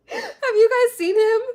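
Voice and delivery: high, loud excited squeal; bursting to share the joke. Real, not acted. Streamer asking chat about the viral man, excited squeal.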